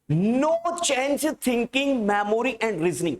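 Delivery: very drawn-out words; slow, measured classroom dictation. Real, not acted. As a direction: dictate at writing speed, stretching each word. Dictating a sentence to write down.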